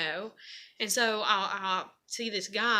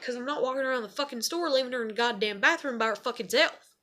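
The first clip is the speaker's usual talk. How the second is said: fast, raised-pitch exasperated comeback; retold angry retort; 4 semitones higher, faster than usual. Mother refusing to leave her daughter alone, exasperated. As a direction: fire it back, fed up.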